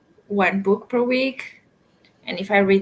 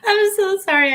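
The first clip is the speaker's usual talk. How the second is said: laughing apology; 10 semitones higher, 5 dB louder than usual. Real, not acted. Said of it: "I can't stop laughing now".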